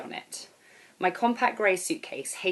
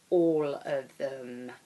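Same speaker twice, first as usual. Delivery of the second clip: slow emphatic threat; 4 semitones lower, much slower than usual. Acted. Airport girl insists.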